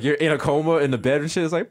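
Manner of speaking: normal talking voice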